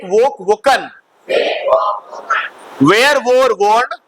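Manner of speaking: projected verb-form recitation with pauses for repeats; classroom drill. Acted. Teacher has class repeat verb forms, drilling voice.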